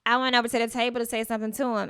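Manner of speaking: ordinary talk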